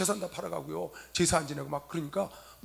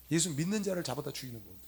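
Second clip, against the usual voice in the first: ordinary talk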